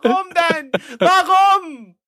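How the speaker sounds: exasperated disbelief; incredulous outburst. Real, not acted. Host protests, pitch jumps.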